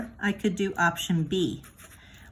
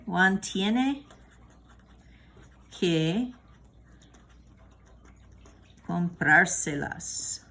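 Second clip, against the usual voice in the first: slow option B with "comprárselas" drawn out; slow model sentence; much slower than usual. Shown as a demonstration. Teacher modelling option B of the sentence slowly. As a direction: stretch "comprárselas", stressing "prár". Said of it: "So that would sound like this".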